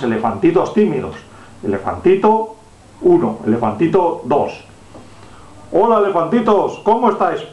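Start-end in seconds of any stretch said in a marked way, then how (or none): 1.6-7.4 higher, sing-song greeting to little ones; reading to a toddler. Pretend. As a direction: coo at the little elephants. Sweet voice greeting toy elephants.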